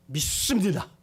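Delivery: the father's first, loud 믿습니다; eager answer. Retold or imitated. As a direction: declare 믿습니다 like a desperate father. Father answers, then repeats.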